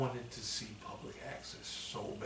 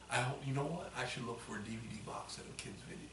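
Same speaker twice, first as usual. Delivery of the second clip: ordinary delivery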